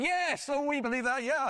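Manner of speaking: high-pitched eager crowd cry; shouted approval. Retold or imitated. Crowd at Niagara shouting belief to the tightrope walker, excitedly. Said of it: "And they shouted".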